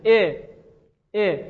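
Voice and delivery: "ا" said as a short e; kasra vowel. Shown as a demonstration. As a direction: break the mouth into an e for "ا". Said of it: "دهن میشکنه".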